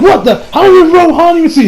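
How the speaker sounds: high, loud shocked question; mock startled. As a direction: gasp it out like you just got caught. Character caught by surprise.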